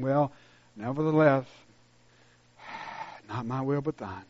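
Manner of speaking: soft, sighing, half-hearted prayer; mock limp prayer. Mocking the congregation's doubtful prayers, sighing softly. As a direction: pray it with no faith at all. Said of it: "like a blanket of doubt".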